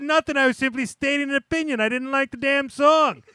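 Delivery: high, loud, indignant retelling; worked-up self-justifying retort; indignant defence. Retold or imitated. Heated retelling of own defence.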